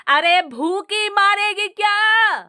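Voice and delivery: high, slow shout. A narrator's reading. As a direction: yell that she is being starved. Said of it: "सास फिर चिल्लाई".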